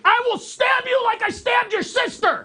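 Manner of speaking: very high, shouted manic threat; unhinged mock shriek at the scammer; pretend psycho threat. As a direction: shriek it like a deranged caller. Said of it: "this is what I'm gonna do".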